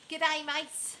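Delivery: high, loud mock Aussie greeting; terrible Australian accent. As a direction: attempt an Australian accent, badly. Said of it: "I can't do Australian accents".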